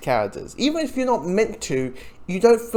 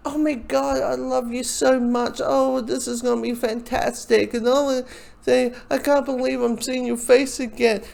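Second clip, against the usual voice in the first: higher, lightly gushing lovers' voice; mock sappy reunion; 6 semitones higher than usual. Reviewer mocking the characters' heart-to-heart, lightly gushing. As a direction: overplay the syrupy reunion a little. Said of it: "Have your heart-to-heart moment".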